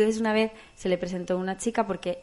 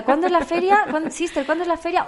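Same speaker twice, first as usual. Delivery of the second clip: higher, insistent asking; eager asking; 7 semitones higher than usual. Retold or imitated. Speaker asking the sister about the fair, higher and eager. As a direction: press her eagerly for the date. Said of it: "Y yo decía".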